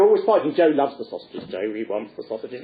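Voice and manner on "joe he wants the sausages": usual speaking voice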